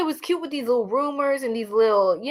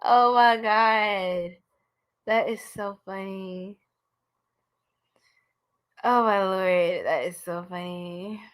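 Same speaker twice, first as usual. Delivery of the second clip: amused; 2 semitones lower, slower than usual. Real, not acted. Cracking up at own chest joke.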